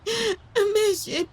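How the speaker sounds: crying in fear. Acted. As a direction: wail that you're scared to die. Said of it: "Arrête de pleurer". (pleurer de terreur)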